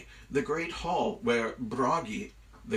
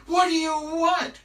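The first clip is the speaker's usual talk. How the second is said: high, loud, hammed-up panic; panicked cry; 8 dB louder than usual. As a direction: cry out like a helpless captive. Loki dragged aloft, crying out.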